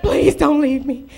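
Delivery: pleading that builds to a loud shout; beg, then cry it out loud on the second please; begging. Retold or imitated. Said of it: "I've been the beggar in the relationships".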